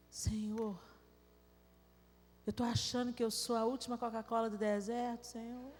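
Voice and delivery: a hint of a tearful prayer; humble prayer. Quiet repentant prayer to God.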